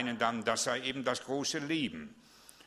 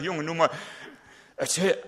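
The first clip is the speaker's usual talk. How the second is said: pastor urging; 5 semitones higher, 5 dB louder than usual. Retold or imitated. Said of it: "Ich sag".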